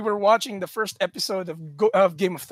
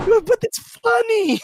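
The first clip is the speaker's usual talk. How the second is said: higher, louder, slow mom reply; mom's voice; 7 dB louder than usual. Retold or imitated. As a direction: play the amused mother.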